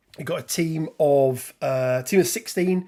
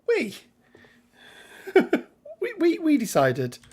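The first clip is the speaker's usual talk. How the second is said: laughing; 13 semitones higher than usual. Real, not acted. Laughing through royal we.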